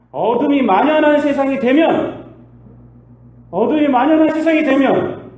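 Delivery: loud, high emphatic proclamation; forceful warning about darkness; preaching cry. Real, not acted. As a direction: proclaim it to the whole church.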